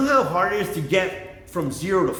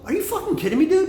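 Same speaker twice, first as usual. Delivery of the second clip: mock disbelief; 13 semitones higher, faster than usual. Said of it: "I go".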